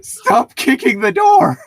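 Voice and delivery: high, loud complaint; annoyed yell. Acted. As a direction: shout at the door kicker. Annoyed neighbor yells.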